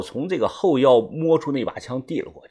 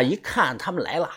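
plain reading voice

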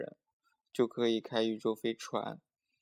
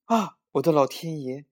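higher, louder exclamation of alarm; shocked cry; 4 semitones higher, 6 dB louder than usual. A narrator's reading. Mother hearing they ate the pills, shocked exclamation. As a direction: cry out aghast. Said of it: "就叫了起来".

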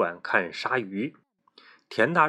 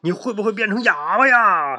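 put-on loud, much higher boyish yell; shouting; 10 semitones higher, 8 dB louder than usual. A narrator's reading. Boy loudly blurts a tactless question to classmate, shouting. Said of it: "田大壮大叫道".

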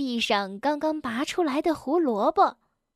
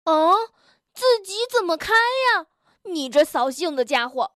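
indignant retort, starting high; yelling back; 4 semitones higher than usual. Acted. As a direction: shout back crossly. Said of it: "跳跳也扯着嗓子叫了起来". (扯着嗓子生气地回嚷)

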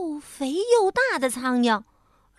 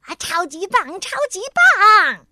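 high, loud, overjoyed shouting; happy cheer; 7 semitones higher, 10 dB louder than usual. A narrator's reading. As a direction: whoop it, stretching the last word. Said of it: "跳跳也高兴地跟他一样跳了起".

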